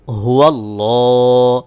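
হুয়াল্লাহ with a thick, full লাম, drawn out slowly; heavy lam. Shown as a demonstration. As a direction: sing হুয়াল্লাহ with a heavy, rounded l. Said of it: "লামকে পোর বা মোটা করে উচ্চারণ করতে হয়". (মোটা লাম দিয়ে হুয়াল্লাহ)

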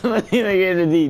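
high-pitched, laughing through the setup; laughing hard. Real, not acted. Streamer repeating a chat prank, laughing hard. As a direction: barely get the joke out for laughing. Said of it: "I'm laughing so hard".

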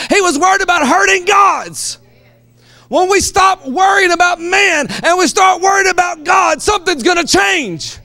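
loud, high preaching shout; rising sermon shout over music; preaching at full force. Acted. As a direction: drive the point home, full-throated.

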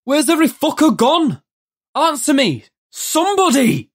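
very high-pitched desperate yell; straining, high calls for an answer; bawled. A narrator's reading. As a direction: bawl it out down an empty street. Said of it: "took a deep breath and bawled".

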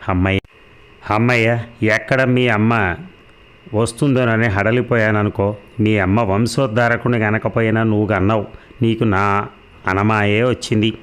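ordinary delivery, nothing marked

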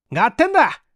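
high, keen got-it; a lively, game reply; eager agreement. Acted. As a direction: jump at the chance. Eager reply to coming adventure.

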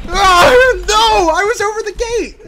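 high, very loud protest; wailing, full-voice game rage; outraged yelling. Real, not acted. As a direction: scream it in furious disbelief. Killed right at the gate.

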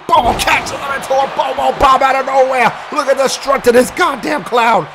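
high, animated match call; excited play-by-play. Acted. Commentator calling Bobo's surprise move, animated.

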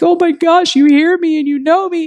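high, teary, overwhelmed prayer; retold weeping prayer, pitched high; tearful cry to God. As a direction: cry it out as if tears are falling. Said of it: "I start bawling".

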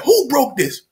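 high, emphatic demand; mock angry dad. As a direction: act out a dad demanding who did it. Voices angry dad demanding answer.

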